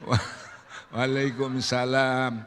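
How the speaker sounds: slow, drawn-out lightly voiced reply; Burhan's hesitant greeting. Burhan answering unknown veiled visitor, hesitant greeting. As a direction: stretch out the reply.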